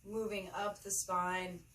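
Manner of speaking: unhurried meditation guide, long pauses between phrases; measured, spacious yoga-teacher delivery; guided meditation. Acted. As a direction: lead them gently through the breath, phrase by phrase.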